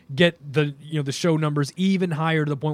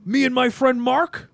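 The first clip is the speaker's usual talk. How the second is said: higher, louder mock-angry promo voice; exaggerated wrestling-promo complaint, pitched up; Triple H; 6 semitones higher, 3 dB louder than usual. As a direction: put on an indignant, mocking rant. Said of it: "Triple H came out and he goes".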